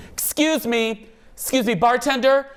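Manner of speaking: high, prissy mock bride voice; high mock bride asking for a drink.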